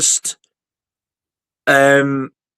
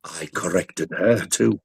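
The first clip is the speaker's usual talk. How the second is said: creepy butler; 7 semitones lower, 7 dB quieter, slower than usual. Retold or imitated. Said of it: "Like the butler".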